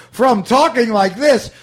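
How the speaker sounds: loud, high-pitched broad delivery; Bill Murray's early style. Shown as a demonstration. Host demonstrating an actor's old technique, loud and high. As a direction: call it out big and high. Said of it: "Bill Murray's change in".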